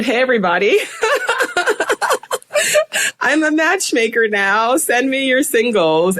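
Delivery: high, laughing, gleeful announcement; giddy public declaration; bubbly announcement. Retold or imitated. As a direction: proudly announce it to everyone. Retold Facebook announcement.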